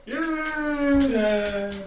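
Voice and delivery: squeaky high yay; high cheer. Acted. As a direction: cheer like a tiny villager. Cheering as villagers.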